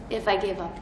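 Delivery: ordinary talk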